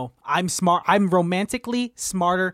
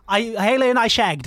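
put-on lively British accent, a little higher; 4 semitones higher than usual. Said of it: "That accent you got right".

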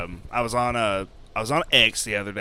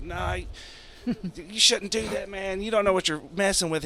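much higher, lightly voiced old woman; old woman warning; 9 semitones higher, 3 dB quieter than usual. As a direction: warn him like a worried grandma. Abuela and priest voiced.

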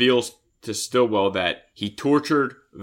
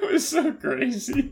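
laughing; 13 semitones higher than usual. Real, not acted. Laughing at dolphin death.

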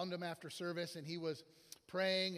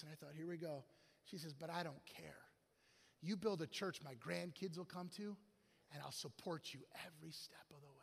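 soft, lowered earnest voice; quiet confiding; 4 semitones lower, 10 dB quieter than usual. Retold or imitated. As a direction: gently voice a supportive older churchgoer. Retells older lady's gentle, supportive confession.

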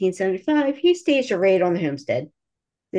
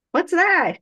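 much higher and louder ditsy question; feigned innocence; 11 semitones higher, 4 dB louder than usual. Pretend. Distracting the guard, a mock innocent higher question. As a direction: play dumb, bright and airy. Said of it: "I'd be like".

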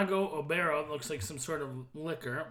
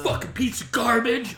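loud, high mock rant; Alex Jones; 6 semitones higher, 9 dB louder than usual. Co-host chiming in on the quoted rant, mock fury. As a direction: join in with a raging impression. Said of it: "Alex Jones".

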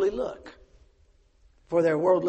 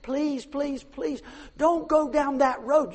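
pleading sermon rising to a shout; fervent preaching; 7 semitones higher, 10 dB louder than usual. Real, not acted. Pastor begging the girls not to go down that road, pleading then shouting.

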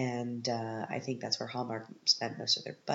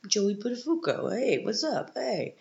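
high-pitched mock Boston and Long Island accent; exaggerated Boston-meets-Long Island caricature; 9 semitones higher than usual. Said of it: "this Boston and then yet Long Island accent".

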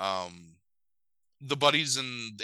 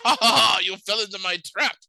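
loud, high mocking villain laugh; gloating villain; 9 semitones higher, 7 dB louder, faster than usual. Villain tricks his rescuers, hammed-up gloating. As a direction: crow over a sprung trap.

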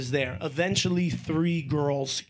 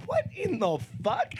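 high stunned disbelief; 7 semitones higher than usual. Retold or imitated. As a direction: pitch jumps up, shocked. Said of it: "I'm like".

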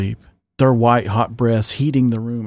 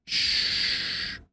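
soft breathy shush; 11 dB quieter than usual. Acted. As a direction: hush someone with a quick shh. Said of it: "He shushed".

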